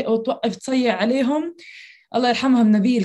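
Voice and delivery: ordinary talk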